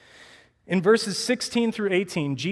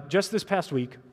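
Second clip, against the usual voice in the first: usual speaking voice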